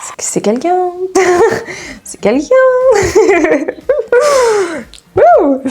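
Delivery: rit aux éclats, très aigu; éclate de rire en montant haut; fou rire. Real, not acted. Fou rire sur Rangiku. (fou rire aigu et sincère)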